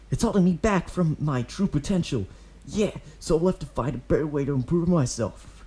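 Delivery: put-on high, cocky kid boasting; proud boy voice. A narrator's reading. Kid boasting to Obito that he left, proud. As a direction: puff up like a tough twelve-year-old. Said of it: "he proudly says".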